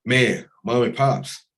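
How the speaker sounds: high, loud, slow laughing cry of mom and pops; sudden delighted outburst at a photo of his parents; overcome laugh. Real, not acted. Emotional reaction to parents photo.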